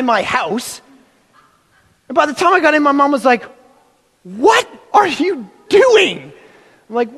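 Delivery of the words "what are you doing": high, slow scolding mom; lightly voiced alarmed mom. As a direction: call him out like a worried mother. Mom confronting son.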